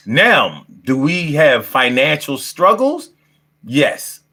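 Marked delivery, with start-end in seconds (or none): none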